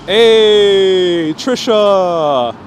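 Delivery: calling out loud and bright. Real, not acted. Streamer calls out a greeting to viewer Trisha, loud. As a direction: hail her across the street.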